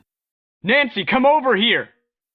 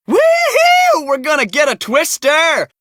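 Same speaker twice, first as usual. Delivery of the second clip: excited whoop; 8 semitones higher than usual. Acted. Storm chaser cheering the coming twister, thrilled whoop.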